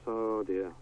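ordinary talk, nothing marked

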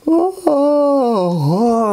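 a big real yawn pulled through the word. Real, not acted. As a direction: yawn it out, high and stretched. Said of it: "I keep yawning".

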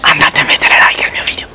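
hushed whisper to the viewers. Real, not acted. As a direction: whisper it like a secret.

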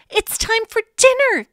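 high, loud call to dinner. Acted. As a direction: call everyone in to eat. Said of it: "called Emily Elizabeth".

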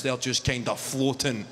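normal talking voice

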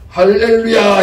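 a sudden loud, high Hallelujah thrown at the dog. Retold or imitated. Pastor greeting the fierce dog at midnight, loud ringing hallelujah. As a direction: greet it with a ringing shout of praise. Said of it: "이러신다".

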